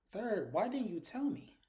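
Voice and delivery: high, slow, mock-indignant question; retold indignant demand. Daughter confronts mother in retold scene.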